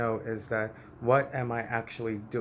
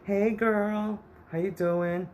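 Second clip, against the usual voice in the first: much higher, chirpy cousin voice; cousin's greeting; 9 semitones higher, slower than usual. Retold or imitated. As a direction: sing out a bright hello as the cousin. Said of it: "my cousin or someone came up to us and says".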